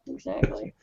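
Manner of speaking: a breathy whispered "Exactly."; whispered echo. Acted. Guest echoes his own agreement in a whisper. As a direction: say "Exactly." again under your breath as a whisper.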